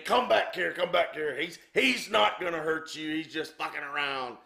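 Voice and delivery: mock yell to coax someone back, easing off; retold yell. Promoter calling the fleeing announcer back, mild retold yell. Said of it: "Jerry yells at Dan".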